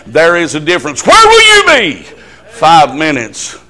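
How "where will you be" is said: high, searching cry. Real, not acted. Preacher to the congregation, a high, loud challenge. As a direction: leap way up on where will you be.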